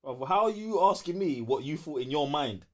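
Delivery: ordinary talk, nothing marked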